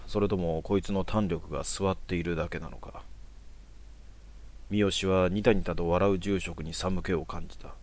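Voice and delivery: usual speaking voice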